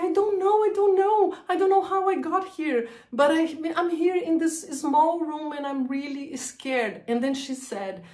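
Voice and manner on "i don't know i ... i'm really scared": much higher, tumbling out in fear; reliving the terror of not knowing where she is; panicked. Retold or imitated. Telling her friend she is lost, in a high panicked voice.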